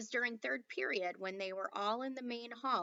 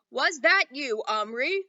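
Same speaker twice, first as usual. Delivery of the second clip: loud, higher accusing question; annoyed headmaster; 4 semitones higher, 13 dB louder, slower than usual. A narrator's reading. Headmaster questioning Omri in assembly, loud and annoyed. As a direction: pin the culprit down.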